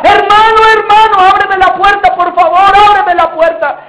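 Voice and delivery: high, loud frantic banging-at-the-door plea; desperate pleading. Acted. Brother at the door begging to be let in, desperate. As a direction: beg to be let in, panicked.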